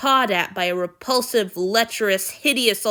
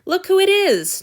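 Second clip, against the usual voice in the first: teasing greeting; 11 semitones higher, 9 dB louder than usual. A narrator's reading. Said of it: "Julia said".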